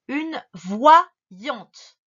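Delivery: VOYANTE split voi - yante, slow; syllable breakdown. Shown as a demonstration. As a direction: punch VOI, then pause before YANTE. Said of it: "tu écris".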